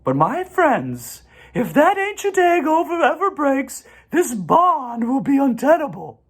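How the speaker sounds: high, gentle spider voice; a kindly spider speaking up; spider's voice. A narrator's reading. Charlotte greets the goose family in a high voice.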